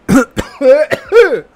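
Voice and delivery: loud sudden coughing; coughing fit. Real, not acted. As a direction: cough hard mid-sentence. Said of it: "Desculpa a tosse aí adicional".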